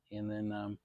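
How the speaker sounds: ordinary delivery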